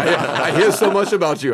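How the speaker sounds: lightly voiced Arnold, laughing through it; Arnold impression. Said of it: "He's like".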